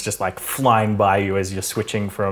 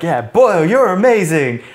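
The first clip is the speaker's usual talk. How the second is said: mock cheering; 8 semitones higher, 6 dB louder than usual. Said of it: "everyone's like".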